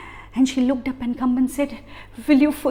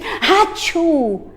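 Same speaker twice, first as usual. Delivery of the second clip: sneeze; 4 semitones higher than usual. A narrator's reading. Sneeze acted out.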